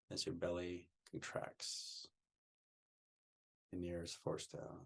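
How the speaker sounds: soft, soothing meditation voice; guided meditation. Real, not acted. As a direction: guide listeners gently into relaxation, leaving long pauses.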